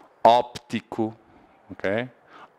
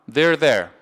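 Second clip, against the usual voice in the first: they're there run together quickly; said fast; 5 semitones higher, 5 dB louder than usual. Shown as a demonstration. Teacher showing how it sounds fast: quick they're there. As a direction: rattle off they're there as one quick phrase. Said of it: "Se eu falar rápido".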